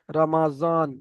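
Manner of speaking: "রমাজন" with a buzzed Z in the last syllable; Urdu pronunciation. Shown as a demonstration. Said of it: "Urdu ভাষার মানুষেরা কেমন বলে". (উর্দু উচ্চারণে "রমাজন")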